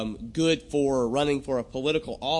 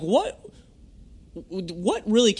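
normal talking voice

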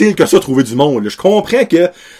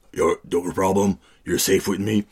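reassuring hero; 7 semitones lower, 9 dB quieter than usual. Acted. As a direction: play the calm protector, voice dropped low. Acts out the hero reassuring migrants. (héros rassurant, voix grave et posée)

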